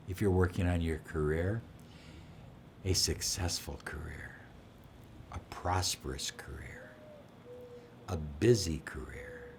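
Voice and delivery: low, soft, fading towards a whisper; soft intention. Shown as a demonstration. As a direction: murmur it into the glass. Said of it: "And I just say".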